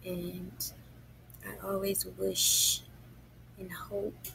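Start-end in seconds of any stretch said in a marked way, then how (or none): none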